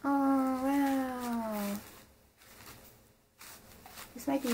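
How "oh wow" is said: ordinary talk, nothing marked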